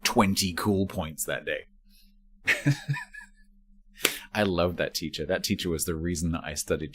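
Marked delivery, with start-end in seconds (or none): 2.5-3.2 squeaky giggle; high laugh. Real, not acted. Laughing at own story.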